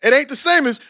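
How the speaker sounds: normal talking voice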